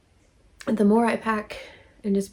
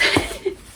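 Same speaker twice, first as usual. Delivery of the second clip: high giggle. Real, not acted. Giggle after gnomes.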